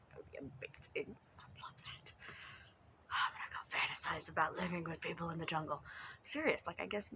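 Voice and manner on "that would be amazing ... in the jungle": soft, breathy daydreaming whisper; dreamy whisper. Real, not acted. Dreamy wish to herself.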